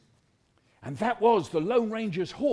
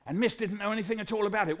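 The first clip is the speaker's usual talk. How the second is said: plain reading voice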